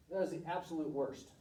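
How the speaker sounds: ordinary talk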